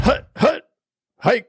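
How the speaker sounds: high shouted football snap count; quarterback calling cadence. Acted. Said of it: "That's from Foosball".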